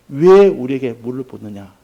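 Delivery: loud, mock-indignant protest; indignant retold challenge. Preacher voices a mourner challenging the water-thrower, indignantly. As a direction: push the first word hard, acting out indignation.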